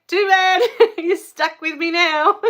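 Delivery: high and loud, laughing through the words; laughing retort. Retold or imitated. Joking to the chat she is staying, laughing loudly. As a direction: toss it off with a delighted laugh. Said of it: "I'm like".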